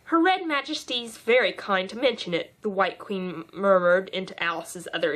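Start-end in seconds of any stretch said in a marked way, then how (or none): none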